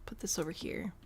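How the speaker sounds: soft whispered aside; murmured under the breath. Real, not acted. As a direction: whisper it to yourself. Quietly placing furniture.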